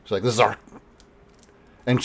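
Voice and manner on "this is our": "This is our," cut off abruptly after "our"; stops herself mid-sentence. Acted. Priestess catching herself before a revealing word, "This is our," cut short. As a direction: break off on "our", leaving "This is our," unfinished. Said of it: "stops herself after "This is our".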